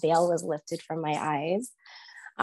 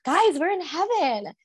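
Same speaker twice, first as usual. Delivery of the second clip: much higher, joyful call to friends; ecstatic; 10 semitones higher than usual. Retold or imitated. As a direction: shout it happily while dancing in the water.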